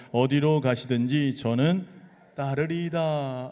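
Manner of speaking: loud, slow scripture reading with the congregation; slow loud group reading. Shown as a demonstration. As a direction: drag each phrase out so everyone reads together. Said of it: "같이 읽어볼까요? 시작".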